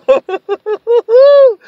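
high-pitched hooting laugh; long falsetto laugh of despair; exasperated laugh. Real, not acted. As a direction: laugh helplessly in a high, drawn-out whoop. Exasperated laughter mid-rant.

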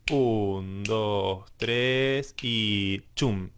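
slow, drawn-out measured count-in; counting the beat. Shown as a demonstration. Teacher showing where the bar falls, slow rhythmic count. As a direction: count slowly in time, landing on chum. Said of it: "El compás entonces viene".

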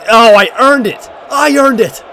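high, loud complaint; indignant protest. Real, not acted. Gamer protesting a teammate stealing his penalty, indignant. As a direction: claim what's yours.

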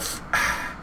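a small unvoiced sigh; copying the opponent's little sigh; imitated sigh. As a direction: breathe out a little mock sigh. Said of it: "Makes that little sigh".

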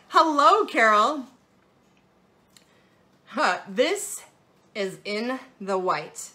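ordinary talk, nothing marked

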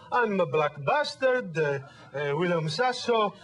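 put-on a lighter Italian dad accent, slow and careful; the dad repeating carefully, lightly accented. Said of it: "just repeat exactly what he said".

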